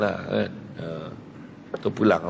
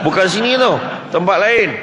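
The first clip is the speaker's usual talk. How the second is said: higher, louder repeat of Bukan sini tau; emphatic raised repeat; louder insist; 8 semitones higher, 5 dB louder than usual. Shown as a demonstration. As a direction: insist firmly, pushing it up.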